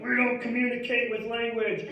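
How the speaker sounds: deadpan brute answer in a put-on voice; mock gruff reply. The boys shouting back at the cop, put-on voice.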